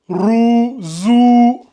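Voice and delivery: long, held "ou" on roux and zou; voyelle allongée. Shown as a demonstration. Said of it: "on l'allonge en deux secondes". (voyelle « ou » tenue longuement dans roux, zou)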